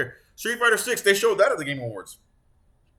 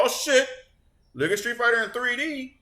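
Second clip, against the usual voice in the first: high excited exclamation; impressed reaction; 8 semitones higher than usual. Retold or imitated. Gamer recalling seeing the new art style, excited. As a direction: recreate being wowed, pitch jumping up.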